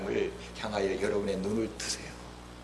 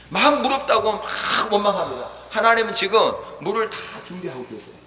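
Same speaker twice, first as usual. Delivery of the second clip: raised, emphatic sermon delivery; full-force preaching; 10 semitones higher, 7 dB louder than usual. Real, not acted. Preacher urging the congregation to see God, full-force preaching. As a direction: press each point home to the congregation.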